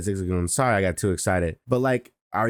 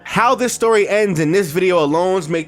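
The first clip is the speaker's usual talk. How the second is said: loud, high-energy opening pitch; hyped video intro; 6 semitones higher, 6 dB louder than usual. Acted. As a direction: sell the series finale loudly.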